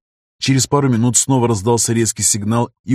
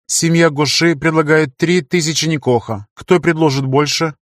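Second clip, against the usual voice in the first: impersonal auctioneer voice; 5 semitones higher than usual. Acted. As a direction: announce the bid like a machine. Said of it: "ровным, безличным тоном".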